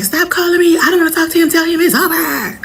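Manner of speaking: high, irritated brush-off on the phone; annoyed ex answering the phone; fed up. Retold or imitated. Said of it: "I answered the phone like".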